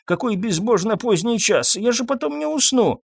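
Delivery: put-on high, querulous elderly voice; plaintive aged complaint, climbing in pitch; grumbling old man. A narrator's reading. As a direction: fuss to yourself in a high old voice. Old pharmacist grumbles to himself.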